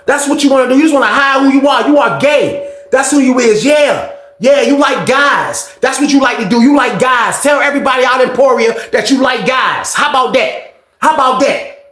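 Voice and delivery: high, jeering holler; taunting tirade. Retold or imitated. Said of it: "he talking to him like that".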